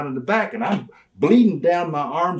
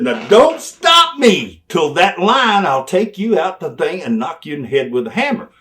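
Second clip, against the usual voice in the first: loud finish; 5 semitones higher, 8 dB louder than usual. Retold or imitated. Manager finishing the promo at Brian, loud and high. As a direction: drive the line home.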